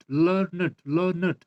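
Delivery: learned repeated as two syllables, LUR-nid. Shown as a demonstration. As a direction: give learned a separate -ed syllable each time. Teacher repeats two-syllable pronunciation. (learned को दो हिस्सों में, लर्-नेड दोहराएँ)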